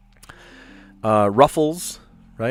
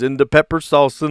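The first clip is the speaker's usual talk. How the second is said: Swedish Chef voice; 5 semitones higher, 6 dB louder than usual. Retold or imitated. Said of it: "He goes".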